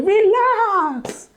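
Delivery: very high, stretched Relax; meme imitation. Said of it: "Have you seen that meme?".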